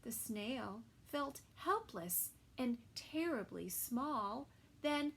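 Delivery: put-on lively storybook reading for kids; reading to children. A narrator's reading. Narrator reading a story to children, lively. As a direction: read it like a bedtime picture book. Reading picture book aloud.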